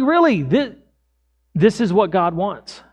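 earnest, insistent plea; urgent pleading. Retold or imitated. Still pleading with woman.

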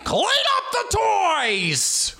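very high, slow bellowed order; Al Pacino. Acted. Pacino yelling at his kids, hammed-up shout. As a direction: roar at the kids, dragging out each word. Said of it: "imagine being Pacino's kids".

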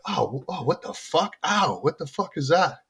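startled ouch. Retold or imitated. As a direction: imitate a pained, confused discovery. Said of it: "he's like".

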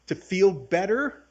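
usual speaking voice

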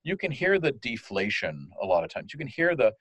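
usual speaking voice

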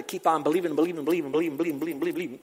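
fast, rolling repetition; sing-song run-on chain; endless believing run. Real, not acted. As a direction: rattle the repeats off like a treadmill. Mimics endless effort of believing.

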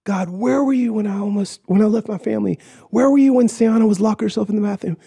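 high, accusing mock yell; retold screaming. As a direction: hurl the questions at God, reenacting another man's screams. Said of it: "he starts screaming at me".

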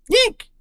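mouse squeak, very high; a sudden shrill yeek of surprise. Acted. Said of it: "Roanoke squeaked".

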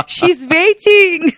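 high, slow, drawn-out plea; imitating dad. Dad pleading for daughter stuck waiting, imitated high holler. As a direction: stretch it out like an indignant dad calling. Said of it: "he's got me on speaker and he's like".

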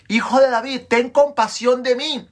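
high, insistent shout for mercy. A narrator's reading. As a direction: cry out harder over the crowd. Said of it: "pero él gritó aún más fuerte".

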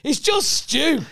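high, drawn-out protest; exasperated insistence. Acted. Doubter rejecting the secret Scouse ingredient, exasperated. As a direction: stretch it out high in disbelief.

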